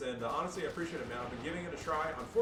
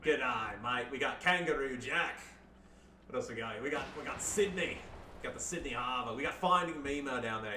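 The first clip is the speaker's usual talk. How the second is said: hammed-up Australian accent; jokey Australian mate voice; Aussie accent. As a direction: lay on a cartoon Australian accent. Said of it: "Down in Aussie land. G'day, mate".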